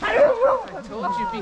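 high, slow, drawn-out call; loud warning call. Real, not acted. Warning someone at corner.

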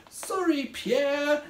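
high, small-voiced apology; apologetic character voice. A narrator's reading. Craig who landed on Pierre, high apologetic voice. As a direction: say sorry as a little storybook creature.

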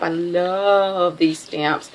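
ordinary talk

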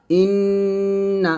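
ইন্না held long, the doubled n ringing in the nose; nasal ghunna. Shown as a demonstration. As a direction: let the nn of ইন্না buzz through the nose, slowly. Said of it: "গুণাটা কিন্তু নাকের মধ্যে বাজতেছে".

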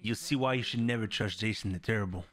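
low, subdued narrator delivery; video intro voice-over. Real, not acted.

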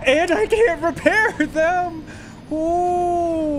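high, loud, drawn-out lament; distressed wail. Real, not acted. Streamer lamenting items that need repair, high wail. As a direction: wail it out over a silly disaster.

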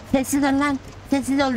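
scolding the car. Retold or imitated. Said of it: "Dedim ki".

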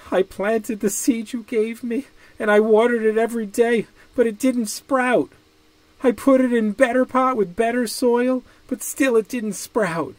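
put-on high, teary voice of a boy pleading; crying child. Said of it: "Ping started to cry".

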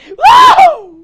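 very high, loud hammed-up wow; mock amazement. Teacher exaggerating wonder at the answer. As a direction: blow it up into huge amazement.

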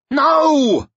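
high loud horrified shout. A narrator's reading. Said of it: "shouted Chael".

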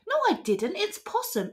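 high-pitched squabbling denial; protesting denial. Acted. Accused wallaby blaming Possum, high protesting denial. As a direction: deny it high and pass the blame.

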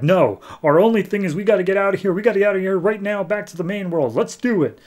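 high, rushed mock panic; hurried, high-pitched panicky retelling; freaking out. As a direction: act out a frantic urge to flee. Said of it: "like, freaking out".

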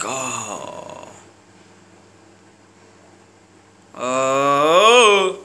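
long tired oh; weary groan. Retold or imitated. Ababa after putting on weight, groaning oh. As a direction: sigh it out like a man weighed down.

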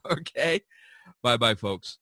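ordinary talk

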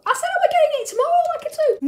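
high, hurried squeaky protest. Acted. Wife defends her missing haircut to husband, squeaky. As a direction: squeak out an excuse quickly. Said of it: "squeaky ass voice".

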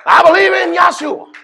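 fervent shout from the pulpit; shouted proclamation. Acted. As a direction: cry it out like a declaration of faith. Preacher's sudden proclamation.

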